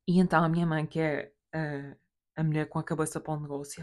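usual speaking voice

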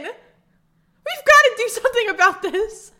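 squeaky distressed outburst; high outraged wail. Acted.

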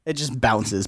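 ordinary talk

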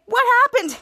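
acted alarm. Bianca to Kid Danger after the elevator falls, alarmed. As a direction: cry it out in mock panic.

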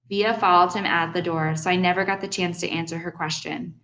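ordinary delivery, nothing marked

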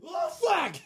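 high yelped oh fuck; mock panic shout. Friend joining the bit as God, loud yelp. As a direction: yelp it in comic alarm.

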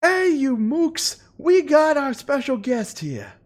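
high, slow, squeaky announcer; high squeaky host voice. Acted. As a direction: greet the crowd in a squeaky showman voice. GM voices NPC host greeting.

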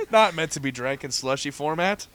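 ordinary delivery

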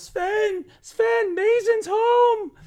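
very high, loud, slow call into the house; yelling call. Acted. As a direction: call out to her husband, drawing it out. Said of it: "she just yells".

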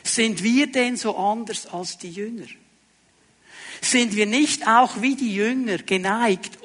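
normal talking voice

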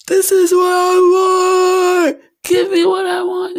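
high, loud, slow crying demand; bratty tantrum. Retold or imitated. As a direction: wail like a spoiled kid.

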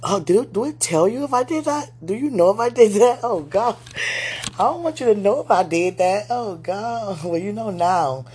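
loud, high flustered confession; embarrassed giggly. Real, not acted. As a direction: blurt it out, giggling.

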